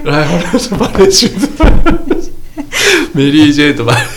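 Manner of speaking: high, loud words through laughter; cracking up. Real, not acted. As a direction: barely get it out for laughing. Joking bar pitch, cracking up.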